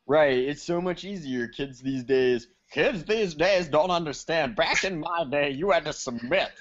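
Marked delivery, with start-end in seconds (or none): 2.7-4.6 higher-pitched mock curmudgeon; hammed-up old-timer grousing. Mock old-timer rant about today's kids. 4.6-6.5 hammed-up curmudgeon reminiscing; playful old-fogey voice; mock old-timer. Mock old-timer rant about the past.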